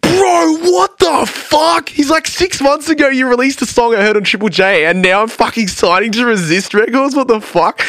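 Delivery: very high, strained disbelief easing a little; losing his mind. Retold or imitated. Huge fan learning of the label signing, hammed-up freak-out. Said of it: "He's, like, losing his mind".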